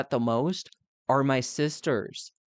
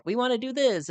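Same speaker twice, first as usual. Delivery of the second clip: high mock-bossy sister voice, pitched up; 10 semitones higher, faster than usual. Imitating sisters outvoting her, high and mocking. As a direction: parrot the sisters' demand, pitched up. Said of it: "all three of your sisters are like".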